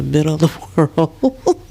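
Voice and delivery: a real laugh breaking into the words; amused, laughing through it. Real, not acted. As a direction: let a giggle slip through. Laughs over turtle doves.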